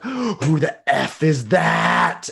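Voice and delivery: high, loud and slow, as the startled dog; a hammed-up dog's shocked cry. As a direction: voice the dog's alarmed "who is that". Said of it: "the dog is like".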